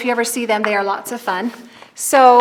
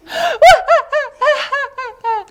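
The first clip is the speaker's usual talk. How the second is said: high wailing laugh-sob echo; crying laugh echo; 14 semitones higher, 6 dB louder than usual. Acted. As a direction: echo the wail back. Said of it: "came the voice".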